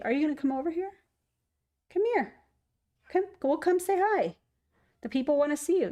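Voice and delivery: high sing-song cat calling; coaxing a pet to come say hi. Real, not acted. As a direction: coax the cat over in a high voice.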